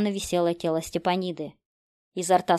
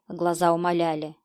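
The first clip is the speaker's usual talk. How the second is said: ordinary delivery, nothing marked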